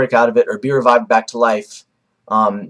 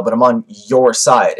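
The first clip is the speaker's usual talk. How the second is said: "your" drawn out and leaned on; slow down and stress the word "your"; slower than usual. Retold or imitated. Kabuto pledging loyalty to Madara, stress on "your". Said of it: "the your was emphasized".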